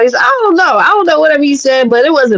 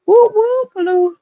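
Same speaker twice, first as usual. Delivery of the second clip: teasing whoop; 10 semitones higher than usual. Real, not acted. Host teasing listeners about Marcus, with a high playful whoop.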